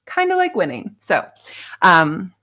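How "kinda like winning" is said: much higher, sing-song kinda like winning; cheeky high repeat; playful echo. Real, not acted. Playful repeat of own line.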